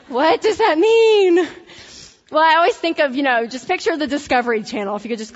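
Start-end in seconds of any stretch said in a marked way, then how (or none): 0.1-1.3 laughing question. Real, not acted. Laughing at own joke.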